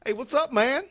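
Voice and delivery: high, loud, upbeat imitation of the coworker's greeting. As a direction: mimic an overly chummy coworker. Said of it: "sometimes he's like".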